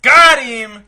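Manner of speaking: loud gleeful gotcha; triumphant shout. Real, not acted. Triumphant joke.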